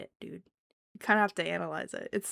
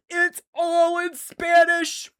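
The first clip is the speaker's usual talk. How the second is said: loud, high, drawn-out exclamation; overexcited fan yell; thrilled outburst; 9 semitones higher, 9 dB louder, much slower than usual. Real, not acted. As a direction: shout it slowly in amazement. Thrilled outburst about the language.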